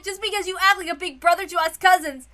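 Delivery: ordinary delivery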